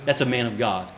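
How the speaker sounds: low, soft awestruck murmur; quiet awe. Retold or imitated. Pastor reflecting on Indonesian humility, soft and awestruck. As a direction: whisper your amazement to yourself. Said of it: "I'm like".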